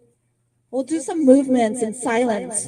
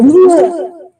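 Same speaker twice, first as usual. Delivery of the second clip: loud high startled whoa; animal whoa; 4 semitones higher, 13 dB louder than usual. Retold or imitated. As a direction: blow it out like a shaken animal. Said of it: "they go".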